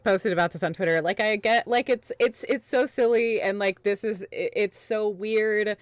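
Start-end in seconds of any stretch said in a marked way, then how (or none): none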